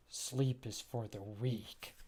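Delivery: low, slow and soft, a grim deadpan; deadly serious. Acted. Jammer brushing off worry, low slow serious voice. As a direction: growl it out like a tireless soldier. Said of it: "Jammer's very serious about his job".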